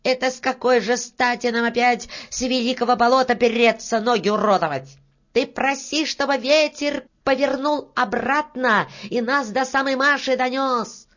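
high-pitched cross scolding; indignant little-man voice; angry shouting. A narrator's reading. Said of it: "сердито закричал Рыжий".

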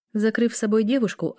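plain reading voice

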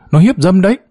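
high yelled accusation; shout. A narrator's reading. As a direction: yell it out, pitched high. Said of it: "hét lên". (hét to, giọng cao vút)

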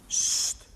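voiceless, drawn-out shush; sharp "sst" without voice. A narrator's reading. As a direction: hush the person at the window. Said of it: "riep hij".

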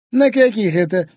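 high, loud suspicious question; jealous demand. Acted. Wife interrogating husband about meetings, jealous. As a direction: press him sharply for an answer.

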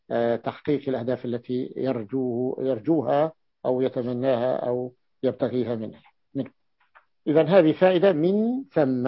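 ordinary delivery

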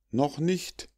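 high-pitched cry without volume; mild mock cry. A narrator's reading. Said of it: "schrien die verlogenen Mäuler".

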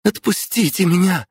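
put-on a young girl yelling to be let go; young girl shouting. A narrator's reading. Captive girl shouts at the thugs to release her, high and loud. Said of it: "крикнула девушка".

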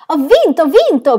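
high, loud, running cry of victory; joyful shouting. Acted. Aunt running to her husband shouting her victory. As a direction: yell it running toward him. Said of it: "gridò correndo verso suo marito".

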